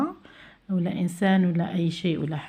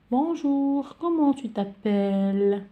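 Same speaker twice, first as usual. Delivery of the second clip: higher, brighter "Bonjour" then a slow question; lightly voiced child; 5 semitones higher, 4 dB louder, much slower than usual. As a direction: play a pupil greeting a classmate. Acting a child's dialogue. (صوت طفل خفيف في حوار)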